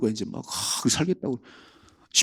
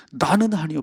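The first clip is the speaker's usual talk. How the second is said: mock Peter denial; 9 semitones higher than usual. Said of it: "오늘 뭐라 그래요?".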